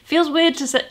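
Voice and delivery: normal talking voice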